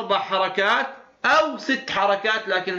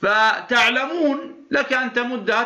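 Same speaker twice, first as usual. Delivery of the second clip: usual speaking voice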